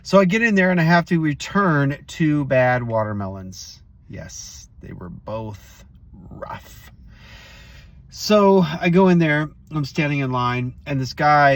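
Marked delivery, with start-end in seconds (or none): 4.1-6.9 hushed, breathy confiding whisper; a quick conspiratorial whisper about the melons; whispered aside. Real, not acted. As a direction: lean in and whisper it like a secret. Aside about bad watermelons.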